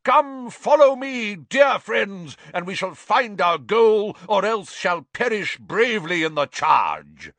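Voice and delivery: high, loud, heroic knight's rallying cry; shouted. A narrator's reading. Knight rallies new friends for a quest, shouting. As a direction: declaim grandly, like leading a charge. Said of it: "clanked to his feet and shouted".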